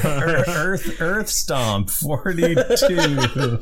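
giggling through the name; laughing. Real, not acted. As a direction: laugh while reading the name. Laughing ad read.

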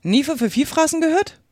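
high incredulous echo question; astonished. A narrator's reading. Griffin exclaiming at Alice never having heard of it, astonished. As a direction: repeat it in amazement, pitch soaring at the end. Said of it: "voller Verwunderung".

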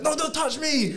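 loud, high-pitched retold snap; hammed-up furious yelp, close to laughing; mock angry outburst. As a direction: imitate a girlfriend pushing someone away in a fit. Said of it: "she's just getting mad".

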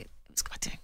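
soft whisper on lovely. Real, not acted. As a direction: drop to a fond whisper. Fond memory of the meeting.

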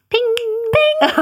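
high, ringing "ping"; sudden bright ping sound effect; ping it out high like a bell; imitated ping.

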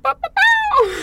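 high, loud mock rooster crow. Sees her bedhead and crows like a rooster. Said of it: "I'm looking like a rooster".

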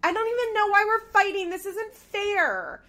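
high, loud mock wail, dragged out at the end; mock victim protest. Podcaster mimicking a cast member who plays victim after fighting, mock protest. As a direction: imitate someone loudly protesting unfair treatment.

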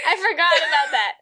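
laughing loudly, breaking up; loud laughter. Real, not acted. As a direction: laugh right into the mic. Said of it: "I laughed so loud directly into my microphone".

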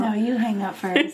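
coy lovestruck phone voice; giggly late night call. Pretend. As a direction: tease like a smitten teen on the phone. Lovers refusing to hang up.

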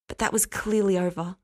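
ordinary delivery, nothing marked

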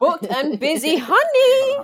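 high, loud laughing sass; sassy hype. Real, not acted.

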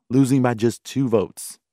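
ordinary talk

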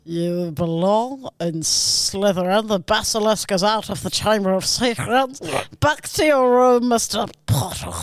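hammed-up, drawling teacher's menace; Severus Snape. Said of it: "Let me go one more time".

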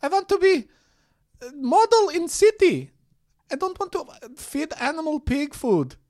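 put-on high, loud Russian accent, broken English; a high, loud mock Russian accent. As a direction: play the Russian farm girl who wants city life, high and loud. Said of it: "she talks very loud".

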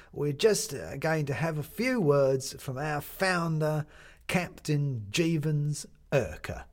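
slow, halting master of ceremonies with many uhs; a fumbling announcer calling for seats; hesitant MC. Acted. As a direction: stall politely while announcing to the room. MC announces the auction speaker.

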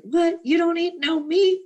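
high, incredulous mock gasp of disbelief; a hint of the shocked family from the film; movie quote, shocked relative. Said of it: "From My Big Fat Greek Wedding".

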